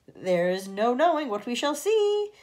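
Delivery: slow, loud declaration; boastful centipede cry; cried. A narrator's reading. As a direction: proclaim it with relish. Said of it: "cried the centipede".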